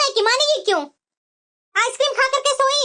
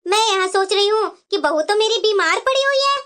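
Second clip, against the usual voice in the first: ordinary delivery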